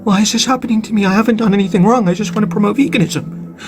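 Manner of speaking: ordinary talk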